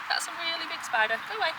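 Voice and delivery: scared. Real, not acted. Shooing a big spider.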